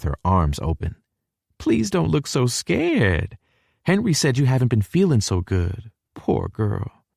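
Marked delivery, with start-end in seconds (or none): 1.6-7.0 Mama's gentle voice. A narrator's reading. Mama comforting Hallie.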